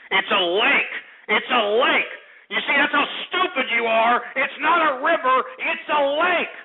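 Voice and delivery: exasperated scolding building up; building berating rant. Real, not acted. Host berating caller.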